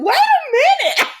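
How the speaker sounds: high, loud startled wait a minute; squealing double take; shocked. Real, not acted. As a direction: stop everything in high disbelief. Shocked reaction to soap scene.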